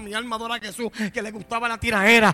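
very loud, insistent sermon voice; full-force preaching. Real, not acted. Preacher confessing his old combative self, shouting.

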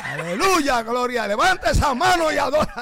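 high, loud preacher rousing a congregation; shouted praise exhortation. Retold or imitated. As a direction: shout praise over their chatter. Re-enacts his shouted praise from pulpit.

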